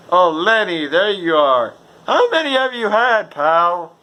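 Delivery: put-on high, loud slurring drunk greeting a pal; hammed-up tipsy cheer; drunken buddy. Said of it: "Or then he's like".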